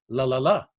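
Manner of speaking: "La, la, la" with only the last la stressed; two light "la" syllables, then land on the third; weak-weak-strong stress. Shown as a demonstration. Showing how the anapest goes, weak-weak-strong stress. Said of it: "It goes".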